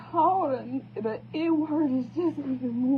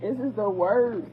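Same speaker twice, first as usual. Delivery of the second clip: usual speaking voice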